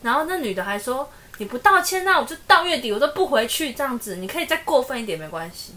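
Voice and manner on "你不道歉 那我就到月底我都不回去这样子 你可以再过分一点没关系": mock angry wife. Wife's angry texted threat retold.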